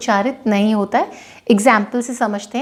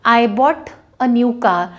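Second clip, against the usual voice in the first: slow example; much slower than usual. Shown as a demonstration. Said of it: "example sentence".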